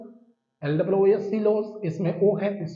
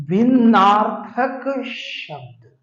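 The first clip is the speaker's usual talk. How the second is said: slow, deliberate term; 4 semitones higher, 4 dB louder, much slower than usual. Shown as a demonstration. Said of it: "teacher spelling out term slowly".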